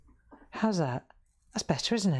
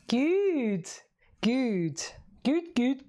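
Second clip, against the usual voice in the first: a hint of a silly higher voice; playful high voice; 6 semitones higher, much slower than usual. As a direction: sing the goods out higher, playfully. Playful repeated approval after tea.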